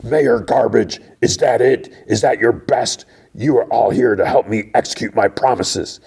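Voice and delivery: high, strained mock yell; throat-straining retold tirade. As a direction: imitate an angry mayor berating the staff. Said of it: "and yells".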